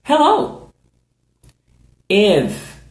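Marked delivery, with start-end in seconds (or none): 0.0-0.7 high model Hello; demo greeting. Shown as a demonstration. Teacher modelling hello for students, high. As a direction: model it cheerfully for learners. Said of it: "You may say".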